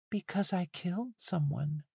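put-on high, soft boyish voice; young boy. A narrator's reading. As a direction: confess quietly in a child's high voice. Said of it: "shrinking back into boyhood".

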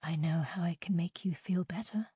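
soft, intimate whisper. A narrator's reading. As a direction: whisper it as a seductive offer. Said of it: "Jade whispered".

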